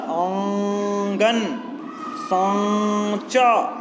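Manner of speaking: आंगन and सांचा drawn out slowly, nasal आ; nasal vowel demo. Shown as a demonstration. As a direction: stretch each word through the nose. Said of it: "नाक से दोनों से हो रहा है". (आंगन, सांचा को धीरे अनुनासिक बोलना)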